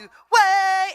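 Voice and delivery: Trinidadian call of "way", high and loud. Shown as a demonstration. Trinidadian dancer teaching a crowd, loud high call. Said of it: "everything is a sing-song 'cause that's how our accent goes".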